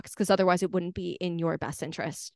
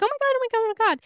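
much higher, louder, giddy squeal; thrilled puppy voice, pitched way up; excited dog squeal; 14 semitones higher, 4 dB louder, much faster than usual. Retold or imitated. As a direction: squeal it like a dog that spots the park. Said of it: "the dog is getting so excited".

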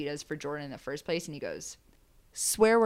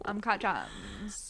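mocking drawl; 7 dB quieter, much slower than usual. Mocking the phrase.

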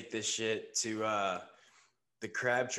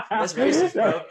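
laughing; 12 semitones higher, 15 dB louder, slower than usual. Real, not acted. Teasing friend through big laughter.

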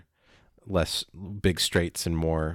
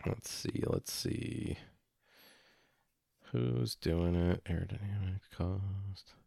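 muttering to himself; 3 semitones higher, 8 dB quieter, slower than usual. Real, not acted. Co-host muttering to himself while looking up which makers are confirmed, quiet and under his breath. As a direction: mumble it to yourself as you search.

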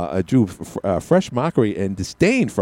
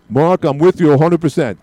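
higher, louder fan calling in; eager caller; 6 semitones higher, 5 dB louder than usual. Pretend. Mock caller cheering on the radio host, louder and higher.

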